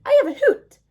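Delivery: high hooting owl voice. Acted.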